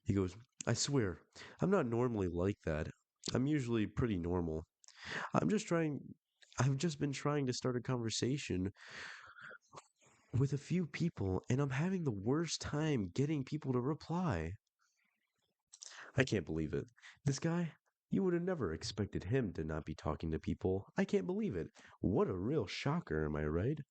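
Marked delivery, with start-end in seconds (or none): 0.7-14.5 higher-pitched, put-on pleading excuse; mock pleading. Man excusing himself to a stranger online, mock pleading. Acting out the man's defensive apology. 16.1-23.8 mock disbelief with big pitch swings; sarcastic. Host mocking the guy nobody replies to, sarcastic. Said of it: "Dropping the sarcasm".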